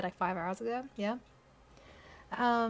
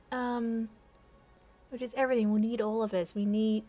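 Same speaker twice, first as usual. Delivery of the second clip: ordinary talk, nothing marked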